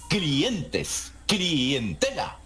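clientes split as cli-en-tes, clientela slow as cli-en-te-la; hiatus split. Retold or imitated. Listener quoting coastal reporters, clientes split into separate vowels. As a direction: break the diphthong in clientes into two beats. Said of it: "Se escucha que dicen".

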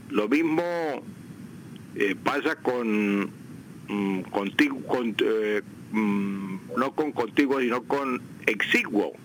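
ordinary talk, nothing marked

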